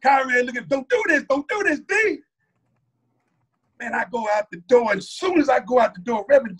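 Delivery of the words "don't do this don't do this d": high-pitched urgent plea; imitated pleading. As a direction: act out a friend begging him to stop. Friend pleads against the plan.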